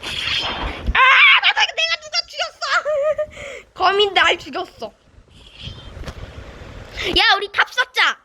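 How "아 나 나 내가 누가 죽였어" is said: high, slow laughing outcry; laughing disbelief. Real, not acted. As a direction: shout through laughter. Laughing disbelief after death.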